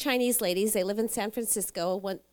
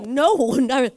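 louder insistent no; emphatic no; 3 semitones higher, 9 dB louder than usual. Retold or imitated. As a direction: push the no's out loud. Retelling emphatic denial.

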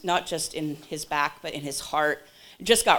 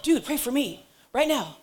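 urgent plea; 9 semitones higher than usual. Retold or imitated. Acting out urgent plea for prayer.

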